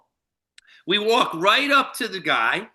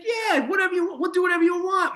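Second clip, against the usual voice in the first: imitating a much higher, effusive yes; eager agreement; 13 semitones higher, faster than usual. Star agrees to sign a picture for his teammate, eager agreement. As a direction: pitch it way up, all obliging.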